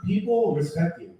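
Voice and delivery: ordinary delivery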